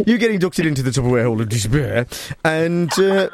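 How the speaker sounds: put-on Brummie interviewer voice; Birmingham accent. Host interviews lunching caller, mock Birmingham accent. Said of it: "I'll do Birmingham".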